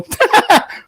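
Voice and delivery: very high mocking laugh; short dismissive cackle; scoffing laugh. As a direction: laugh in the mugger's face, high-pitched.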